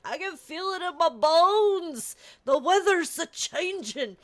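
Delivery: put-on slow, high folksy old-timer drawl; creaky old prospector predicting weather. Complaining about her hip, she plays a weathered old-timer. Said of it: "that's how I'm like".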